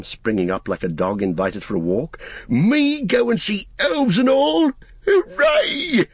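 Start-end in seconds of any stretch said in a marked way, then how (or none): 2.5-6.1 very high, slow-building glee; overjoyed shout. A narrator's reading. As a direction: exclaim in delight, ending in a cheer. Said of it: "he shouted".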